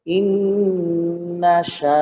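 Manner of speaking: slow, with the vowel of শা stretched; one alif long. Shown as a demonstration. Teacher showing how long the vowel in শা should be. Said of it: "এক আলিফ লম্বা হবে".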